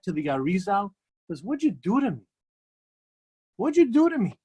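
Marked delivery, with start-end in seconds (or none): 3.6-4.3 higher and louder, accusing; indignant outburst. Retold or imitated. The man accusing the Arizal, higher and louder. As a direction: throw the question back hard.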